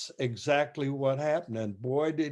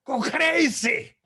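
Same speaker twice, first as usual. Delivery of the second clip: a much higher, louder mimic of the conductor; impersonating the conductor; 10 semitones higher, 4 dB louder than usual. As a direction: play the fiery maestro, urging madness. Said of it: "he says".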